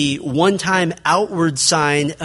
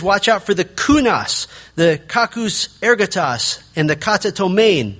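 higher and louder, "kunos", "kakus", "katatomein" with hard spat k sounds; spitting out the k; 5 semitones higher, 3 dB louder than usual. Retold or imitated. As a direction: spit out every k in "kunos", "kakus ergotos", "katatomein". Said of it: "You can almost hear him spitting out the k".

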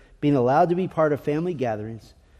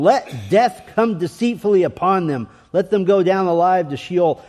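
raised, forceful scripture reading; intense climactic reading; 3 semitones higher, 5 dB louder than usual. Acted. Preacher re-reads the psalm's emotional climactic moment, loud and intense. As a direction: declaim the verse with mounting intensity. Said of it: "emotional climactic moment of the psalm".

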